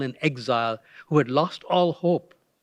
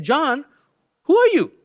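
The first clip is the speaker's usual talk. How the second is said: high, sharply raised challenge; demanding question; 10 semitones higher, 5 dB louder, slower than usual. Retold or imitated. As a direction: press him hard for his name. Delegation demands John's identity.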